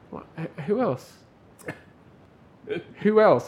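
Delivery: high, insistent retold query; retold challenging question. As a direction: press for names, lightly acted.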